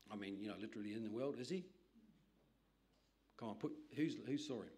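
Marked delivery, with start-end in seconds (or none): none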